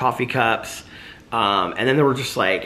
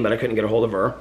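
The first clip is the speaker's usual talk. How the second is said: usual speaking voice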